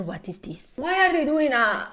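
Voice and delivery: ordinary talk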